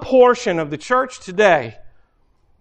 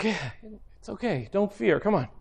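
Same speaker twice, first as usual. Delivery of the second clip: gentle comfort; 11 dB quieter than usual. Retold or imitated. God voiced comforting Daniel.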